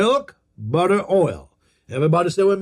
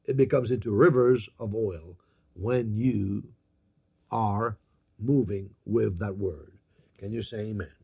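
dropping low; 7 semitones lower, 7 dB quieter than usual. Acted. Preacher drops voice on oil.

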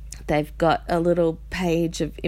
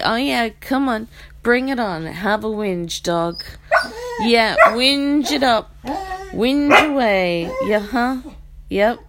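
loud mocking goad, coaxing a whine; loud taunt at a whining dog; 5 semitones higher, 4 dB louder than usual. Real, not acted.

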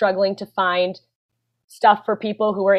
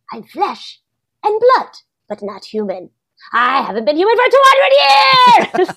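hammed-up creature voice rising to a high, loud cry; campy monster-movie delivery, ending high and loud; bad dramatic acting; 8 semitones higher, 4 dB louder than usual. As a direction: overact a tortured immortal, breaking into a wailing shout. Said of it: "You can have some fun with it".

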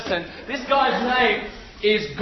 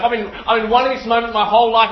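ordinary talk

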